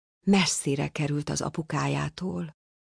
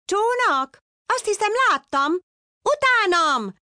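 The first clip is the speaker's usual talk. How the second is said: much higher, louder, bubbly fish voice; eager shout; 4 dB louder than usual. A narrator's reading. Little blue fish excitedly calls the father to follow. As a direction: cheer him on, follow me. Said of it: "kiáltotta lelkesen egy kis kék hal".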